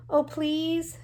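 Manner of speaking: high wheedling plea through a pout; pouty pleading. Acted. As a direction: coax like a spoiled sister asking a favor. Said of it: "smushed up her mouth into a little pout".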